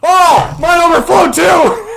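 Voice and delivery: very high, loud laughing yelp; startled shout. Real, not acted. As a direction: shriek it as your drink foams over. Own drink suddenly overflows too.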